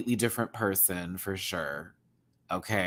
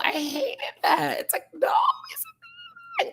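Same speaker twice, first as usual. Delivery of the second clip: laugh-crying distress; slower than usual. Real, not acted. Recalling boy's arm bitten off.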